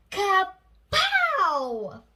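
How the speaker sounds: loud kapow; explosion shout. A narrator's reading. Narrator voicing the third missile hit, loud. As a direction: boom it out.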